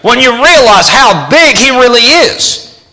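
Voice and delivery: high, loud preaching climb; fervent preacher's peak. Real, not acted. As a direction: preach it out with full force. Preaching at full force.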